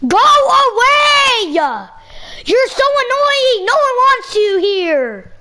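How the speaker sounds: slow, drawn-out angry shouting; real exasperated yell. Real, not acted. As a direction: drag every word out, fed up. Sibling quarrel during live stream.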